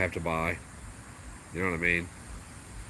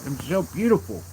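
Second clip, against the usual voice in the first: doting coo; 6 semitones higher, 5 dB louder than usual. Real, not acted. Cooing over the dog.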